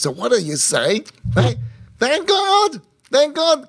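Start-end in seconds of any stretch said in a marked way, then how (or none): 2.0-3.7 high, bursting thank-God of relief; relieved thanks. Retold or imitated. A donor freed from giving thanks God in high relief. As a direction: exclaim it in sudden relief, well above your voice.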